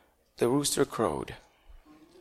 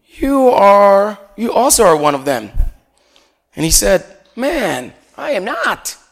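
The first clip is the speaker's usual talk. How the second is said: dramatic scripture reading; 3 semitones higher, 6 dB louder, slower than usual. Acted. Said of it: "someone else saw him and said".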